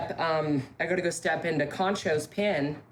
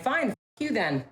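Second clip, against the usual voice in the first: retold anger; 7 semitones higher than usual. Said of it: "whenever I get really angry".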